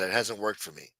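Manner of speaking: ordinary delivery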